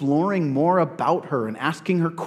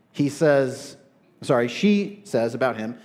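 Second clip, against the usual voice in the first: ordinary delivery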